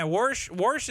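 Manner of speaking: "Warsh" with an R added to wash; southern "warsh". Shown as a demonstration. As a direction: say wash as "Warsh", rhyming with marsh or horse-sh. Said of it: "that's more south".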